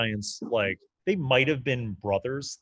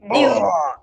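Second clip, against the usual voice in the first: high, loud pained retch; a sudden choked cry as he's punched in the gut; weird gut-punch noise; 10 semitones higher, 8 dB louder than usual. Acted. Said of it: "you hear him make this weird noise".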